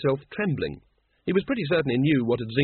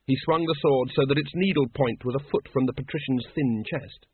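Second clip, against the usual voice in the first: plain reading voice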